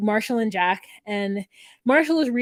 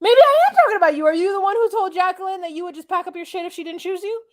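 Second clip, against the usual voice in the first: much higher, louder, rapid-fire mock rage; mock angry outburst; 9 semitones higher, 4 dB louder, much faster than usual. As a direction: fire it at him like a jealous rival. Marshall confronting Josh.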